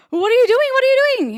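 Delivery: high, loud, panicked double question; the friend bursting in, shouting in alarm; flustered shout. Retold or imitated. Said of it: "all flustered".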